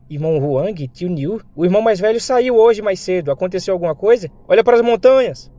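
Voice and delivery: put-on high-pitched girl's voice, rising to a loud call; little sister. Sister questioning two comrades back at home, high girlish voice. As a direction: lift the voice high, end on an urgent shout.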